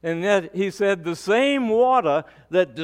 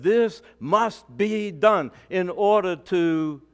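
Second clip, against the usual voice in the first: slow, low, solemn words of Jesus; 4 semitones lower, slower than usual. Acted. A pastor voicing Jesus to his congregation, slow and solemn. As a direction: lay each word down slowly, gravely. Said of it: "And Jesus said".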